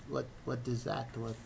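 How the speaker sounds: soft bewildered retold question; confused quiet question. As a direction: ask it small and lost. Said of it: "She's like".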